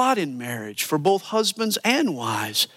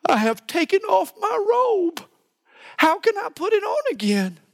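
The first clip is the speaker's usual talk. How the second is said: much higher, mock-whiny wife voice; whiny wife excuses. Preacher mimics the wife's excuse to her husband, high and whiny. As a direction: play the reluctant wife making lame excuses. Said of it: "she offers some really lame excuses".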